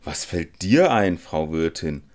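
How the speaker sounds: incredulous challenge; astonished. A narrator's reading. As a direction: protest in amazement, pitch leaping mid-phrase. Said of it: "sprach der Zirkelschmied staunend".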